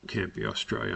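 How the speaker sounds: normal talking voice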